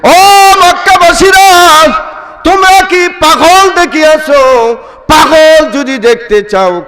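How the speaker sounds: preacher mimicking a yell through the streets; imitated shouting. Abu Jahl calls out to the Meccans, imitated high shouting. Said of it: "চিল্লায়া চিল্লায়া বলতেছে".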